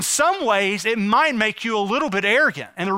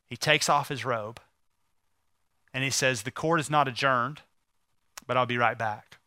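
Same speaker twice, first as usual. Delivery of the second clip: soft, low and much quieter, a tender sermon story; quiet and tender like a sermon climax; hushed tender storytelling; 7 semitones lower, 5 dB quieter than usual. A narrator's reading. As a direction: draw them in with a hush as the father forgives. Quiet tender retelling of father.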